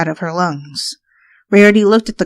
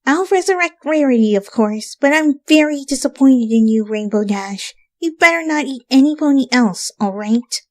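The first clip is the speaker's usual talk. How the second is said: disappointed Twilight; 7 semitones higher than usual. A narrator's reading. Twilight scolds Rainbow before leaving. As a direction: scold like a let-down teacher.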